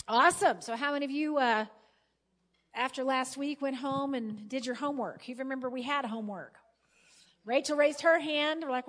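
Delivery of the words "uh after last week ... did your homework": usual speaking voice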